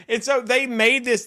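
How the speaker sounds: ordinary talk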